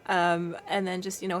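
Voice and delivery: usual speaking voice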